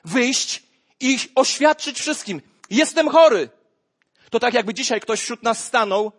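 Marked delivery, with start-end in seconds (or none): 0.0-3.5 slow, higher, loud proclamation; loud declaration. Acted. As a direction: announce it to everyone, slowly. Preacher voices public confession. (głośne, wolne wyznanie)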